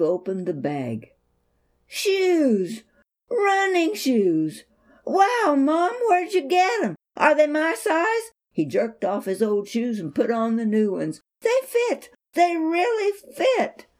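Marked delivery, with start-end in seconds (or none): 1.9-8.3 high, thrilled cry of surprise; boy discovering new running shoes, high and thrilled; excited boy. Acted. Boy opening bag of shoes. 11.4-13.9 high, thrilled cry of surprise; boy discovering new running shoes, high and thrilled; excited boy. Acted.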